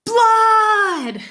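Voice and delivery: high, loud drawn-out yell; full-voiced storybook alarm. A narrator's reading. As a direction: scream it at a passing police car. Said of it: "pointed to her brother and yelled".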